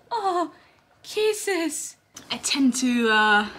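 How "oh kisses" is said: high cooing kisses. Real, not acted. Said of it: "I also talk in a baby voice like this".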